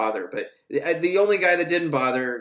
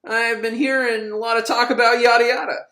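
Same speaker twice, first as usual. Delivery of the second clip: higher, louder mock tough guard; menacing guard Muncie; 7 semitones higher, 3 dB louder than usual. Guard Muncie grilling inmates over cards, hammed-up menace. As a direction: lean in like a suspicious bully guard. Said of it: "Muncie comes in, and he's like".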